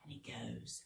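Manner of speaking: hushed whisper. Shown as a demonstration. Leader whispering before the song starts. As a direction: breathe it like a secret.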